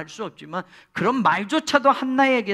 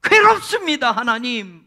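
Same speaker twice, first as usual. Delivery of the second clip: higher, louder lament to God; anguished prayer; 7 semitones higher, 10 dB louder than usual. Retold or imitated. Childless woman praying to God, loud anguished lament. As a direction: cry out to God in grief. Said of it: "하고 기도할 때마다".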